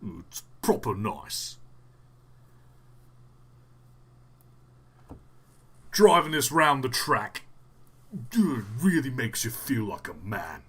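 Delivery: a hint of a TV presenter, measured and emphatic; poor attempt at a Clarkson voice; Clarkson impression. Shown as a demonstration. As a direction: lightly imitate a car-show host, slowing for effect. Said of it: "Can you do a Clarkson impression?".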